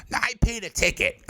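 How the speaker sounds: high-pitched mock protest; whiny heckler. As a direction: imitate a drunk woman objecting. Acts out drunk heckler woman protesting.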